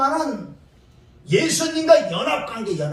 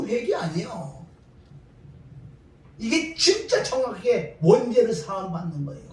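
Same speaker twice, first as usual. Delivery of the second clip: ordinary talk, nothing marked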